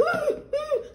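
sharp high gasp, acting out a tantrum. Shown as a demonstration. Teacher demonstrating a tantrum to the class, sharp high gasp. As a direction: show the kids a dramatic tantrum gasp. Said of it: "going like".

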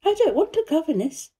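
put-on high, sharp little girl; sharp refusal. A narrator's reading. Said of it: "said Mary sharply".

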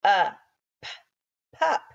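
segment pup into sounds; sounding out. Shown as a demonstration. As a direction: break the word apart sound by sound, p, uh, p. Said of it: "you should have sounded out p".

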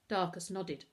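usual speaking voice

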